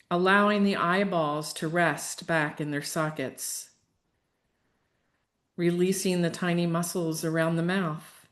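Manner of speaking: unhurried, even relaxation cues; guided meditation. Real, not acted. Leads a yoga nidra relaxation.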